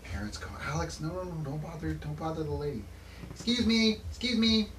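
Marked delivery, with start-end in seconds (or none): 0.6-2.8 worried parent. Acted. Parent scolding child. 3.3-4.7 put-on high, eager child calling; little girl voice.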